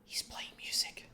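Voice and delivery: hushed, excited whisper. Real, not acted. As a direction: breathe it out in awe. Music starts, he whispers excitedly.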